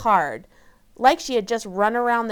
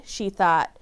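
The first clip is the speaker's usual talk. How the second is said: usual speaking voice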